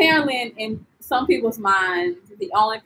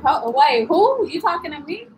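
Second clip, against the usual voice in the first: high-pitched, puzzled double take; mock bewilderment; 5 semitones higher than usual. Tourist reacting to vendors' name for her, bewildered. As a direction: act taken aback, pitch jumping up.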